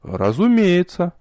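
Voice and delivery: high, slow surprised reply. A narrator's reading. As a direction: answer as if surprised. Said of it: "удивлённо отозвался я".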